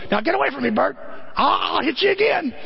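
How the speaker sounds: high yelled warning; mock yelling. George shouts at Bert to get away, high yell.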